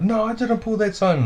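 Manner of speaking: high mock yell of denial. Beaten guy denying the wrong gang sign, high yell. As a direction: shout the denial indignantly. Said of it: "he starts yelling out".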